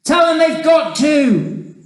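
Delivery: high, loud insistent order; slow, urgent yell; shouting back. A narrator's reading. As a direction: shout a firm reply down below. Shouted exchange with those below.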